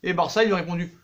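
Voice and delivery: ordinary delivery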